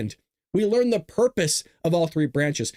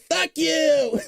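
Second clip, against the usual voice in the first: Trump impression; 10 semitones higher than usual. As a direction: blurt it like a brash president. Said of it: "you know what Donald Trump did? He said".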